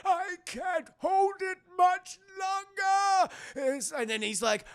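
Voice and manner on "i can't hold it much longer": high, loud, drawn-out villain cry; mock strained wail. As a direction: overact straining to hold on. Mimics Palpatine straining under lightning.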